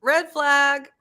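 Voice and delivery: high, loud alarm call; calling out a red flag; alarmed warning. Real, not acted. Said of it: "warning comment".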